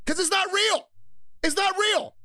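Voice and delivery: very high, strained exclamation in short bursts; exasperated cry. Real, not acted. Host driving home why pretending gets old, high exasperated yell.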